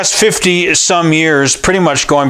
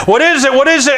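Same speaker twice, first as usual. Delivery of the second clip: alarmed question; 11 semitones higher, faster than usual. Retold or imitated. Pastor alarmed by a running man.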